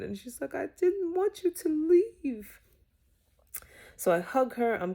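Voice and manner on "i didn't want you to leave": put-on high, slow, teary child's whimper; drawn-out, pitched-up sob voice; imitating a crying child. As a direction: mimic her daughter's weepy plea. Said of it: "she starts crying again".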